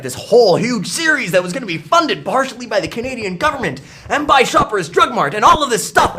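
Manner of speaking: high, over-excited build-up; mock hype. Shown as a demonstration. Recalling a cartoon that flopped, mock grand hype.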